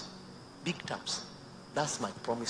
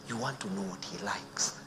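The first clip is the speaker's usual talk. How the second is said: ordinary talk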